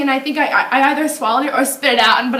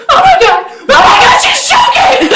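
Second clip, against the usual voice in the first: high, loud shrieking alarm; panicked sister shout; 14 semitones higher, 11 dB louder than usual. Retold or imitated. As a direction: scream it like a frantic sister who sees her choking. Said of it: "my sister comes over and she's like".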